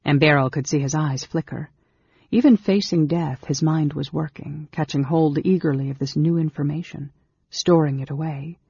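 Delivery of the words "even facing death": ordinary delivery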